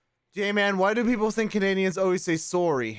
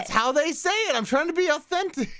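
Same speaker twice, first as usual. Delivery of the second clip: high-pitched defensive protest; amused protest; 12 semitones higher than usual. Real, not acted. Streamer defends the accent to partner, high amused protest. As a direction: protest your innocence, voice shooting up.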